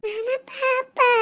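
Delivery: slow, tearful little plea; close to crying. Acted. Child pleading with uncle for the baby, tearful. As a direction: draw it out, nearly crying. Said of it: "விட்டா அழுதுருவான் போல".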